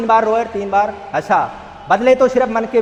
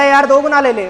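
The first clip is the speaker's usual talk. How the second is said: pushy coaxing, raised and louder; coaxing buyer; 6 semitones higher, 7 dB louder than usual. Retold or imitated. Buyer urges the son to sell, loud coaxing. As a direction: lean in and tempt him with double.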